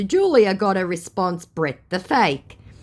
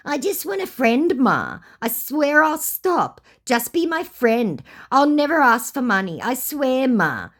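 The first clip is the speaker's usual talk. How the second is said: mock begging; 9 semitones higher than usual. Scammer begging her to be his friend, mock pleading. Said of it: "beg for money".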